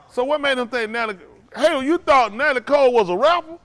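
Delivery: high, rising astonishment; incredulous challenge. Real, not acted. Host challenges absurd answer.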